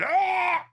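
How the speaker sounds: high startled cry; a sharp real yelp of shock. Real, not acted. Reacting to feet content.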